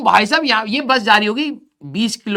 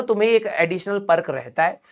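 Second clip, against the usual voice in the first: ordinary talk, nothing marked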